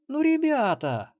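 put-on slow, high pleading child's voice; pleading drawl. A narrator's reading. Granddaughter begs the two to tell, slow and high. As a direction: draw it out, begging. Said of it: "умоляюще протянула внучка".

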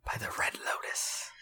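soft unvoiced whisper. Real, not acted. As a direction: sneak the spoiler in under your breath. Spoiler muttered under breath.

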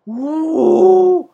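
high, drawn-out loud "woo"; high loud whoop. Retold or imitated. Podcaster imitating a singer's high note, high whoop. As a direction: belt out a mock high note.

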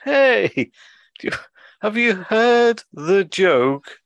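loud, high, painfully drawn-out sloth; slow sloth. Retold or imitated. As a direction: stretch every word as far as it goes. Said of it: "the sloth turns to his cohort".

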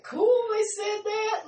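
high-pitched mock excited outburst; imitating Cody's reaction. Quoting Cody's delight at the song, high mock excitement. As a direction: imitate him, voice jumping way up. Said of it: "Cody was like".

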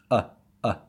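"uh, uh" each cut off sharply in the throat; glottal stop. Shown as a demonstration. Teacher showing the sound found in Arabic, glottal stop. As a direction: say "uh" with the breath stopped at the glottis. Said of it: "this is exactly like this".

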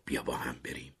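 softly, gently asking; softly inviting; quietly. A narrator's reading. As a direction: say it low, just for her ears. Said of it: "تری آهسته به کارولین گفت".

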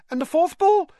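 very high, loud piping question; curious schoolboy fan. A narrator's reading. Colin asks Harry about the last ball, eagerly. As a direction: jump in eagerly, boyish treble.

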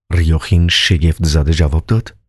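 usual speaking voice

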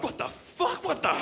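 high-pitched, stunned double take; outraged disbelief. Acted. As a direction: squawk it in appalled bafflement. Acting bogans seeing the Devo outfit.

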